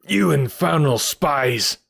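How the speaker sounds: slow, furious accusation in character; angry cry. A narrator's reading. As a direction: cry it out as the enraged landlord. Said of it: "the man cried".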